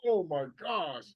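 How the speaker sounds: acting out a dawning realisation; stunned by revelation; sudden epiphany. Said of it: "you get an epiphany".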